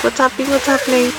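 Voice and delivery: mildly hyped-up mock puppy voice; hyped-up puppies. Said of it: "all hyped up".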